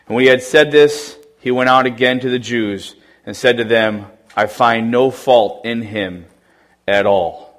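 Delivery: solemn scripture reading. Acted. Reads John eighteen aloud.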